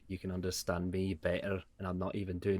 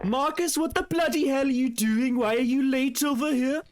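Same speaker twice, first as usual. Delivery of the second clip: mock yell; 6 dB louder than usual. Yelling at late friend on call.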